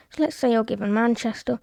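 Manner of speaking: ordinary talk, nothing marked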